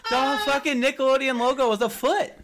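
high, loud, laughing outrage; excited laughing rant. Real, not acted. Friends gossiping about Nickelodeon, loud laughing outburst.

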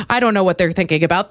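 normal talking voice

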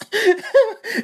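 short high-pitched laugh; laughter. Real, not acted. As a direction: burst out in a quick high laugh. Laughing at own story.

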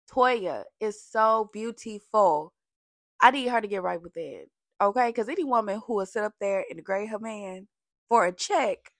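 normal talking voice

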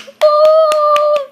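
a high, loud cheer of triumph; high loud celebratory woo; excited whoop. Real, not acted. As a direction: whoop like you just won. Celebrating learning a new word.

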